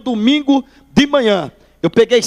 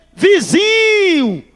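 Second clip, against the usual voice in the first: long, high drawn-out call of "Vizinho!"; cheerful call; 8 semitones higher, much slower than usual. Retold or imitated. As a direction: stretch "Vizinho" way out, much higher. Pastor calls out cheerfully.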